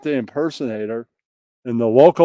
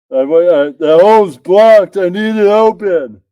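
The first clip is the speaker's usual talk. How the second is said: shouting; 5 semitones higher, 8 dB louder than usual. Acted. Acted sex scene, shouted.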